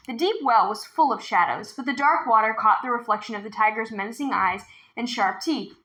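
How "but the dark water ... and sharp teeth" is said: ordinary delivery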